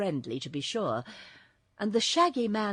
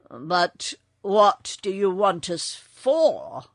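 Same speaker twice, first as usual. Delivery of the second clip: slow, loud and uneasy question; drawn-out, mildly uneasy call; 8 dB louder, slower than usual. A narrator's reading. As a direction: ask it slowly with a touch of worry. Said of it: "asked the Shaggy Man uneasily".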